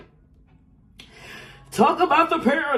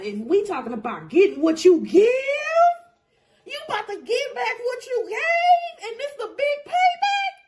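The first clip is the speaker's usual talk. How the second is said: high-pitched, impassioned cry; passionate yelling; 13 semitones higher, 3 dB louder than usual. Real, not acted. Reader promising the listener payback, passionate yelling. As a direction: yell it out high, rising with passion. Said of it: "I'm sorry for yelling".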